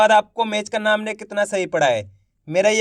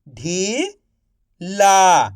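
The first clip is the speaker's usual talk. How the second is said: slow stretched reading; much slower than usual. Shown as a demonstration. Teacher to pupils, slowly stretched. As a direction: draw ढीला out slowly. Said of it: "आप इस तरीके से".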